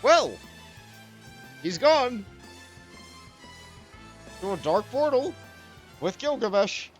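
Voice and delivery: high, loud exasperated outburst. Real, not acted. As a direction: throw it out in disbelief. Exasperated exclamation.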